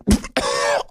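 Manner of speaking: sudden high fake coughing fit; fake choking cough. Mock choking cough after quoting Zuckerberg. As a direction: pretend to choke on the words. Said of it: "I just choked on Zuckerberg's words".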